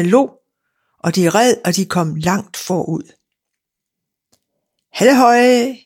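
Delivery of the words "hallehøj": higher, raised hallo cry; a cheerful holler from behind; calling out. A narrator's reading. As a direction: sing out the greeting down the road. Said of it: "råbte Klods Hans".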